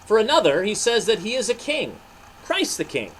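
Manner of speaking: lively read-aloud storytelling; story narration. A narrator's reading. Narrator reading a Bible story to boys and girls, lively. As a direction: tell it to the children, animated.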